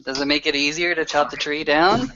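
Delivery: ordinary talk, nothing marked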